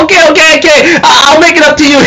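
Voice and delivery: high, fast, flustered reassurance; flustered apology. Retold or imitated. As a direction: rush it out, scrambling to apologize. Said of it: "He goes like".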